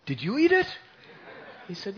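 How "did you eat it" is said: high, loud suspicious demand; accusing mother. Acted. Mother accuses her son of eating the offering, loud and high. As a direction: catch him out.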